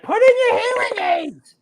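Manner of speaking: put-on high nagging girlfriend voice. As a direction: nag him like his girlfriend. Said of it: "my girl's always like".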